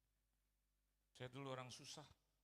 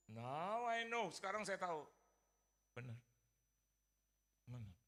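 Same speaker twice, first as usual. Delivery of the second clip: ordinary talk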